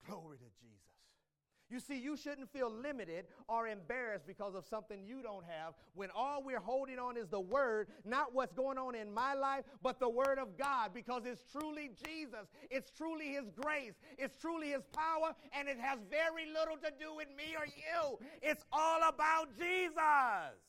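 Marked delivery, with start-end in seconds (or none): 1.7-18.2 loud, rising preacher's cadence; building pulpit fervor; fervent preaching. Acted. Preaches at full force. 18.4-20.6 loud, slow, high shout; full-voiced pulpit climax; preacher's shout. Real, not acted.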